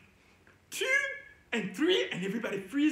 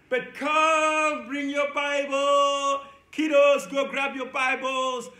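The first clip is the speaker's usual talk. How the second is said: loud, high, drawn-out call; 3 semitones higher, 6 dB louder, slower than usual. Real, not acted. Teacher calling kiddos to fetch Bibles, long loud shout. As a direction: holler as if across the house.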